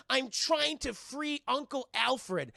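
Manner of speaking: slow, high mock sincerity; earnest claim. Barbara tells Robin her plan, slow and earnest. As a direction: drag it out, wide-eyed. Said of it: "She says".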